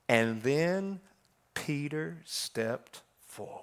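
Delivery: low, slow near-whisper of the verse; hushed reading. Shown as a demonstration. Preacher leaning in to the congregation, hushed whisper. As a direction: breathe the words as a reverent secret. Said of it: "I want you to listen to this".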